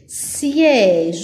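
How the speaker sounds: "Siège" as a lone stretched syllable, siè; drawn-out S. Shown as a demonstration. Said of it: "j'entends S". (« Siège » en syllabe isolée et allongée)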